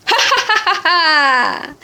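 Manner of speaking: high mocking ha-ha laugh; tiger laugh. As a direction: laugh down at the tiny prey. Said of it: "The tiger laughed and said".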